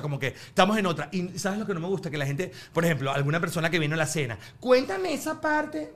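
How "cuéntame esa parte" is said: high, slow, wheedling fan voice; mock pushy fan. Comedian mimicking a dinner guest begging, high and slow. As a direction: drag it out like a fan begging for the joke. Imitating fan asking for bit.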